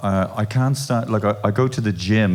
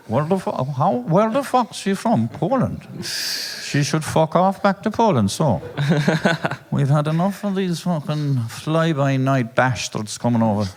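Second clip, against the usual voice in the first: mock bigoted local in a Kerry accent, higher; 5 semitones higher than usual. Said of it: "I think it was a Kerry accent".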